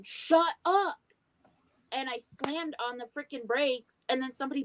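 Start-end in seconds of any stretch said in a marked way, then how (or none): 0.0-1.0 retold yell. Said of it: "ended up yelling".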